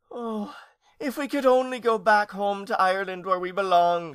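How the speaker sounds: high, loud homesick moan; moaning lament. A narrator's reading. Aunt longing for home in Ireland, moaning. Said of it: "she moaned".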